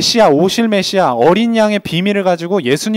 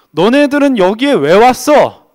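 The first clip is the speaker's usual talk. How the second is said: rebuking shout; 5 semitones higher, 6 dB louder, slower than usual. Acted. John the Baptist rebukes Pharisees.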